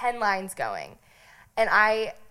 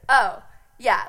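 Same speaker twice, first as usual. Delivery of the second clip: louder breezy shrug; 8 dB louder than usual. Retold or imitated. Bluffing to the check-in girls, louder breezy shrug. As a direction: toss it off with fake confidence.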